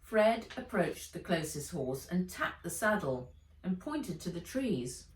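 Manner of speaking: plain reading voice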